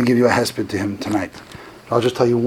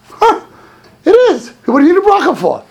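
high, loud mock astonishment; acted incredulous retort; 6 dB louder than usual. Old rabbi answering a blessing request, acted incredulity. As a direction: imitate a baffled, exclaimed comeback.

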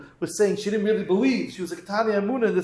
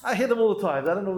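ordinary delivery

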